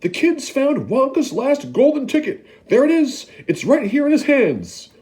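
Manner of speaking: excited scream. Acted. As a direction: call the whole shop over. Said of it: "screamed the shopkeeper".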